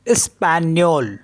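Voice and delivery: स्पैनियोल with gn sounded as nyo; teacher sounding स्पैनियोल with ny; French pronunciation. Shown as a demonstration. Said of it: "E S P A जी एन O L".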